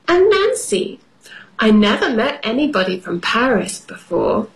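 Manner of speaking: usual speaking voice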